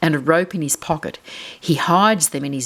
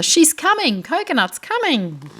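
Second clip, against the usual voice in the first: put-on excited children; 11 semitones higher than usual. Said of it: "The children smile at each other".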